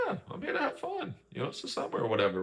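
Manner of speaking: high, soft then fast, breezy mock Lorenzo; carefree Lorenzo. Podcaster imitating Lorenzo's carefree summer attitude, mock breezy. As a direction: breeze through it like nothing matters.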